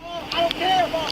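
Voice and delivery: muffled behind closed glass; through a car window. Real, not acted. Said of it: "I can't hear you through the window".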